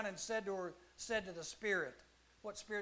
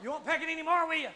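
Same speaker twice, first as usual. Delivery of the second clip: mock threat; 8 semitones higher, 11 dB louder, faster than usual. Teacher threatening pen-tapping student.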